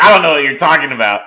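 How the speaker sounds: playful fake denial. Laughing denial to DeAndre.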